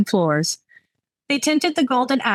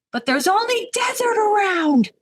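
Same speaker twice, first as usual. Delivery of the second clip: put-on drunken wail; 13 semitones higher than usual. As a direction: wail it high without real volume. Drunk Clara complains, hands in air.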